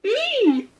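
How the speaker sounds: very high, drawn-out pained cry; groaning frog. A narrator's reading. Stung frog groans to the animals, high and pained. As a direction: wail the word in pain. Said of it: "groaned the frog".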